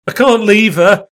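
high, loud desperate protest; desperate cry. Acted. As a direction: cry out in refusal to let go. Greg refuses to let go.

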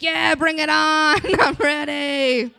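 drawn out and hungry; mock eager dude; thirsty guy. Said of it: "some thirsty guy out there being like".

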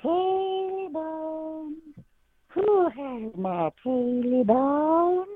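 high, slow, drawn-out ghostly wail; haunting voice. Acted. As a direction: moan it like a ghost in the woods. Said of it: "he hears".

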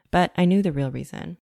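ordinary delivery

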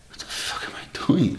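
unvoiced whisper of disbelief; hushed inner thought; shocked whisper. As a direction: whisper it to yourself, stunned. Inner thought seeing legless man.

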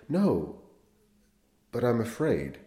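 put-on scared boy pleading with the priest; frightened whimper. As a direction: let the fear rise into a whimper. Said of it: "The voice rose to a shrill whimper".

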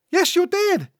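squeaky sidekick insisting; high-pitched contradiction. Acted. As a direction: argue back in a very high voice. Cecil argues back.

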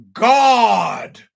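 high, loud, theatrical cry of God; dramatic exclamation. Retold or imitated. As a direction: proclaim it like a revelation.

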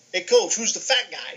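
put-on brash, rude New York kid accent, pitched a touch up; bad New York accent. Rude freshman asks his coach about the fat guy, in a bad New York accent. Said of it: "'Cause I had a bad New York accent".

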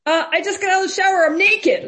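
high, loud, rushed mock panic; panicky voice. Teenager calls to police from the shower, imitated in panic. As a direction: blurt it out like a flustered kid. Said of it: "this sort of panicky voice".

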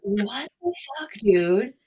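high, drawn-out outrage ending loud; retold mad yell. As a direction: scold the dog in exasperation, building in volume. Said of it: "I'm so mad at her".

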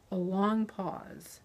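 plain reading voice

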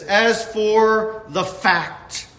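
each word of "As for the fact" drawn out slowly; slow right down on "As for the fact"; slow emphasis. Shown as a demonstration. Preacher pointing his listeners to the wording, slowly. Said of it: "And notice the emphasis".

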